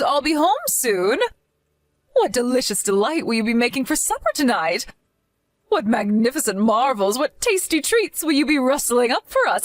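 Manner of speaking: high, syrupy fake sweetness; sugary mother-in-law. Mother-in-law fawning over her returning daughter, syrupy and high. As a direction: gush over your daughter while scheming underneath.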